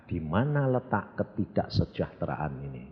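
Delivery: usual speaking voice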